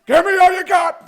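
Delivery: sudden high, loud movie-star shout; Al Pacino. Acted. Host launches toilet news with a shouted Al Pacino impression. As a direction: yell it like an over-the-top screen gangster. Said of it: "The Al Pacino's popped up again".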